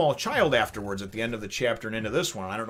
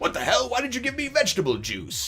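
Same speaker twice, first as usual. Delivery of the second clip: much higher exasperated voice; imagined Frankie; 12 semitones higher, 4 dB louder than usual. Retold or imitated. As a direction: gripe at the reindeer. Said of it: "I still picture him being like".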